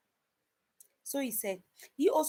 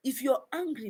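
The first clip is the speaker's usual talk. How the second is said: a louder, higher restatement; louder repeat; 4 semitones higher, 7 dB louder than usual. Real, not acted. Repeated line, second said louder.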